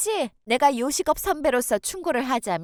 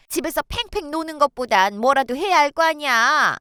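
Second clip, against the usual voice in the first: ordinary delivery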